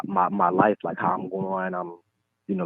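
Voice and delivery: ordinary talk, nothing marked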